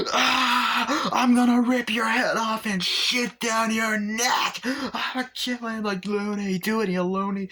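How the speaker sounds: high, loud, slow shouting through tears; furious crying rant. Retold or imitated. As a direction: scream at him, half sobbing. Teddy loses it at junkyard man.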